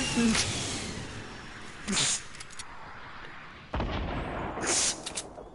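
long airy laugh fading out; breathy laughter. Real, not acted. Gamer laughing after launching his friend, long airy laugh.

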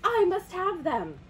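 high, loud, urgent wanting; eager determination. A narrator's reading. Toucan wants the fireflies, high and eager. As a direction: declare it with greedy urgency.

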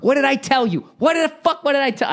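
high, loud mock scolding; furious movie-scene yelling. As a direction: lay into them as the enraged film gangster. Re-enacting angry yell from scene.

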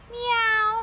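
squeaky kitty meow; cat meow. Acted. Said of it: "said Cat".

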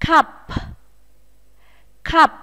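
Cup ending with a puff of air on P; aspirated P. Shown as a demonstration. Teacher models Cup for pupils, final P aspirated. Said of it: "cũng phải bật hơi nhé".

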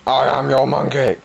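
ordinary talk, nothing marked

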